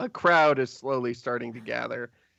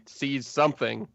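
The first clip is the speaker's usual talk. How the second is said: normal talking voice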